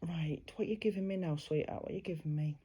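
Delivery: soft hushed aside; whispering. Real, not acted. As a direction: murmur quietly to the spirit. Medium whispers to spirit.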